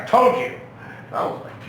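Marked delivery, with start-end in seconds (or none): 0.0-0.5 higher and louder, a sharp reprimand; sister scolding. Retold or imitated. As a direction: land it like the slap itself. Sister after slapping him.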